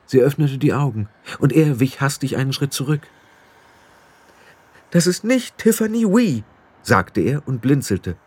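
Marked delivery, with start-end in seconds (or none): 4.9-6.4 high, shocked blurt; boy startled that the girl is not Tiffany, high surprised voice. A narrator's reading. Roland shocked at the sleeper.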